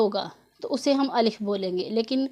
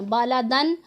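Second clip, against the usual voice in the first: very slow, drawn-out recitation of Bala दन आमीनन; Quranic recitation; much slower than usual. Shown as a demonstration. Teacher reciting the tanween example slowly for her students. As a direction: chant Bala दन आमीनन slowly with a clear तनवीन. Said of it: "गुन्ना नहीं करना".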